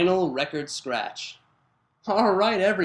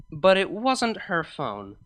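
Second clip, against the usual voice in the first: ordinary delivery, nothing marked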